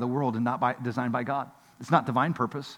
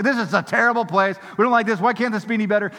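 whiny complaining; 6 semitones higher, 4 dB louder than usual. Retold or imitated. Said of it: "all we do is moan and groan and complain".

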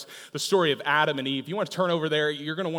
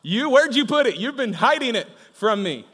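higher, louder accusing outburst; scolding a partner over lost keys, half-joking accusation; mock blame; 6 semitones higher, 5 dB louder than usual. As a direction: play the petty blamer pointing the finger. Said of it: "I am so quick to be like".

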